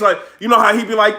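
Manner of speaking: ordinary talk, nothing marked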